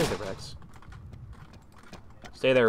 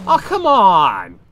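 frustrated; 3 semitones higher, 10 dB louder, much slower than usual. Real, not acted. Frustrated at being killed.

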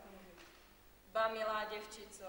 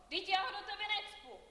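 indignant protest; 7 semitones higher, 4 dB louder than usual. Acted. Aunt rejects blame, indignant.

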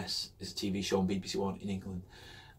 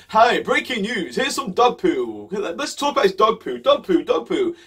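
loud, slow, higher hammed-up newsreader; mock news anchor; 9 semitones higher, 9 dB louder than usual. Mocking how the news hypes dog poo, loud anchor voice. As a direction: ham up a breathless breaking-news anchor. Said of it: "zooms in at this dog poo and goes".

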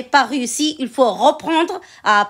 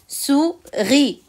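"Souris" split as sou-ris, higher pitched; syllable by syllable; 4 semitones higher than usual. Shown as a demonstration. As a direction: say "Souris" in two drawn-out beats, sou, ris. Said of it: "Je découpe les mots de la fiche en syllabes". (« Souris » découpé en syllabes, sou-ris)

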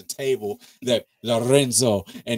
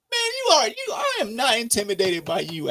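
jumping in as Tariq, fired up; sudden squeaky outburst; 9 semitones higher than usual. Retold or imitated. Mock confronting the short character.

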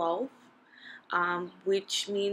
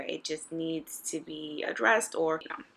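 usual speaking voice

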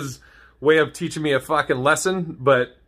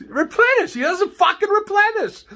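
much higher squeaky imitation of the angry dad; high mocking voice of the fuming father. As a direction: parrot the dad, pitched way up. Mimicking the dad from the clip.

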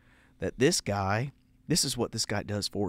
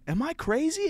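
mock disbelief. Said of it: "And I'm like".

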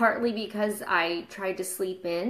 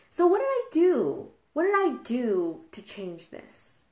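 normal talking voice

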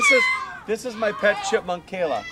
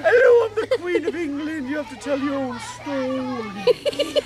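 Queen of England; 3 semitones higher than usual. Pretend. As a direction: play a grand queen, stretching the words. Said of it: "That was voices".

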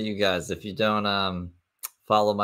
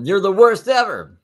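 mock yell; 10 semitones higher, 9 dB louder than usual. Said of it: "I know".

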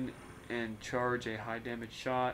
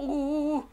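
squealed high ooh; high delighted ooh; 4 dB louder than usual. Real, not acted. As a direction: let out a thrilled ooh. Delighted reaction.

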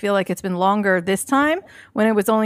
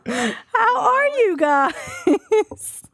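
laughing; 13 semitones higher, much slower than usual. Real, not acted. Laughing through a greeting.

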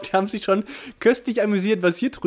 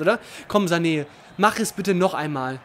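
normal talking voice

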